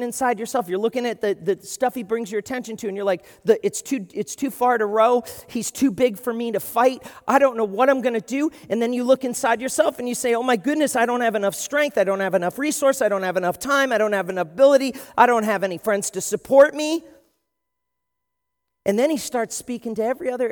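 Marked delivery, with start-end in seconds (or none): 3.4-8.5 louder, rising panicked complaint; overwhelmed panic. Retold or imitated. 10.3-17.0 anxious self-doubt. Retold or imitated.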